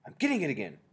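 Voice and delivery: loud, urgent halt; crying out. A narrator's reading. Mildred telling the witches not to move, cried out. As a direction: call out urgently to freeze everyone. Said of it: "cried the witch, called Mildred".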